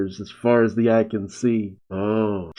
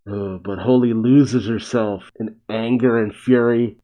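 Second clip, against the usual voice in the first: ordinary delivery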